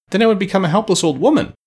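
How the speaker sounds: witch's high, aggrieved outcry; dismayed old witch. A narrator's reading. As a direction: wail in objection at the prospect. Witch protests at her fate.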